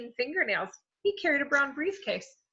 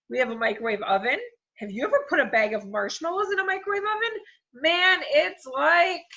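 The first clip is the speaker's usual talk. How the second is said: excited rush; 6 semitones higher, 3 dB louder than usual. Acted. Excited child rambling.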